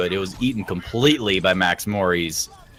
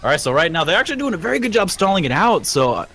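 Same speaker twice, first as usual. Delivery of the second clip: getting excited; 7 semitones higher than usual. Real, not acted. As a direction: let the hype build in the call. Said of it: "I'm actually getting kind of excited".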